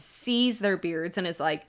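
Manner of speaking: ordinary talk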